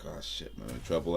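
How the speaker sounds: low, very soft mock thought; hushed aside of someone in trouble; quiet inner worry. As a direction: say it under your breath, as if caught out. Retold inner dread at being scolded.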